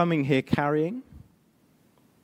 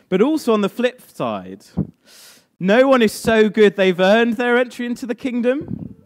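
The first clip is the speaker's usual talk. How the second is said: high, loud emphatic preaching; preaching at full force; 8 semitones higher, 4 dB louder than usual. Real, not acted. Pastor warning the self-righteous, loud and high. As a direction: press the point hard.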